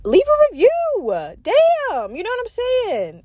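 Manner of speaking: high-pitched, playful exasperated plea; mock exasperation. Podcaster nagging listeners to leave a review, mock exasperation.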